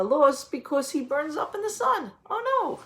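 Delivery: exaggerated storytime alarm, rising to a high oh no; mock dismay. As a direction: play up the shock for the kids. Seed burns up in story.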